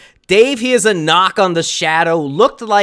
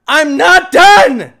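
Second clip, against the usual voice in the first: higher and loud, quicker; shout; 7 semitones higher, 6 dB louder, slower than usual. Real, not acted. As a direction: yell it again more clipped. Repeated shout.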